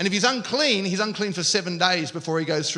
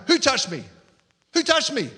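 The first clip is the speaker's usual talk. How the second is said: higher, sharp demand; accusing yell; 6 semitones higher than usual. Shown as a demonstration. Jesus misread as shaming the woman, accusing yell. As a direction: demand the culprit, as if scolding a crowd. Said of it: "I always read it with this tone".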